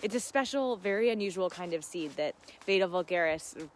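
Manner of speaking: ordinary talk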